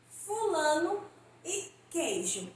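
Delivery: fulano e queijo said with the last o sounding like u, slowly; final o as u. Shown as a demonstration. Teacher showing students final o said as u. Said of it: "foram pronunciadas como se terminassem com a letra U".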